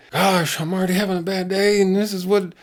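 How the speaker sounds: high, loud whining boy impression; hammed-up kid complaining about a bad day; upset kid. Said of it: "Terry did not like that".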